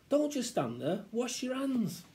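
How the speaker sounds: high, scolding order; bossy mum. Acted. Mum orders child to wash hands, bossy. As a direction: chivvy a dawdling child.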